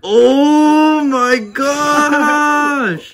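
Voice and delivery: drawn-out high, loud gasp of awe; excited exclamation. Real, not acted. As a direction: stretch it out in amazement. Sees beautiful male tarantula.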